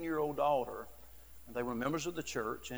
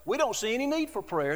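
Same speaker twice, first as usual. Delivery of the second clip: normal talking voice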